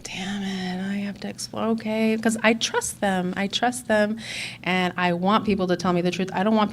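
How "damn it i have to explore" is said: whispered grudging resignation; whispering, reluctantly accepting; resigned whisper. Retold or imitated. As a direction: mutter it under your breath, giving in.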